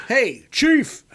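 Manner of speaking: a hint of Brooklyn; Brooklyn accent. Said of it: "here's my New York Brooklyn accent".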